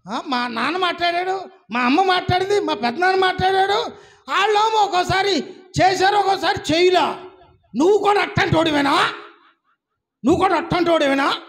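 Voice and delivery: loud, high scolding; woman scolding God in prayer; mock quarrel. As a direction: pick a fight as she did.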